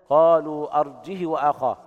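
chanted أرجه with a kasra on ه and no long i; no hamza, short kasra. Shown as a demonstration. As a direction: recite أرجه as arjihi cut short, arjih, no hamza. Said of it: "بدون همزة".

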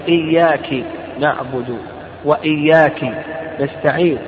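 "إياك" and "وإياك" ending in kasra, iyyaki, said slowly; wrong final vowel. Shown as a demonstration. Teacher demonstrating a mistake that changes meaning, both "إياك" ending as iyyaki. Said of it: "هذا لحن يحيل المعنى".

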